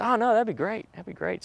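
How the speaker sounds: high, imitated woman's reassurance, softening at end; agreeable woman. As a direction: lift the voice high to imitate her assent. Annika reassures him at breakfast.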